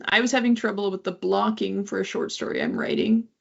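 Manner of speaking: ordinary delivery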